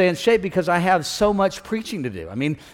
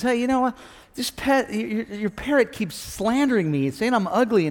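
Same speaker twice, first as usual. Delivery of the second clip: higher-pitched, affronted customer; offended complaint; 6 semitones higher, 4 dB quieter than usual. Acted. Lady complaining to pet store owner, offended.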